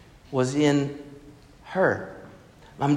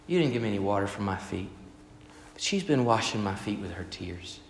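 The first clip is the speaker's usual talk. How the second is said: softer, lower, a tender reproach; gentle rebuke; 5 semitones lower, 6 dB quieter than usual. Retold or imitated. Jesus telling Simon about the weeping woman, softly. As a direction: let the comparison sink in gently. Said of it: "He said".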